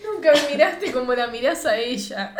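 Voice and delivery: can't keep a straight face on the line; quoting through laughter. Retold or imitated. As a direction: quote broken up by giggles. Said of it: "Estoy tentada".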